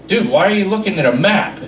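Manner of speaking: ordinary talk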